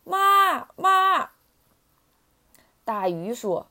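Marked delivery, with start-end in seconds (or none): 0.0-1.3 high, loud tadpole calls; shouting for mum. A narrator's reading. Tadpoles hailing the big fish, high loud calls. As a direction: yell up to her in a high little voice. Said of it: "就对着大鱼喊".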